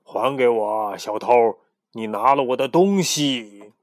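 a pharaoh accusing a thief; loud, slowing angry accusation. Acted.

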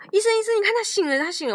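high calls to the doctor; excited call. Retold or imitated. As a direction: parents calling out to the doctor in joy. Parents call doctor in dream.

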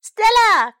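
very high, loud call; high-pitched, full-voiced shout; excited cry. A narrator's reading. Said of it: "cried Sam".

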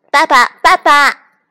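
put-on high, loud baby duckling call. A narrator's reading. Duckling calling its new papa, loud and high. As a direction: shout for papa in a high little voice.

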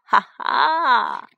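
loud, drawn-out evil "ha ha"; triumphant bandit cackle; big gloating stage laugh; villain laugh. A narrator's reading. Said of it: "怪声笑道".

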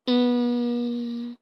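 long, level "hmm"; pondering hum. Acted. Ferdinand puzzling over the castle wall, humming doubtfully. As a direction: hum on one note while thinking. Puzzled frog scratching his head.

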